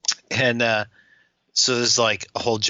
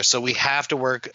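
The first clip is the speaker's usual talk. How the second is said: ordinary talk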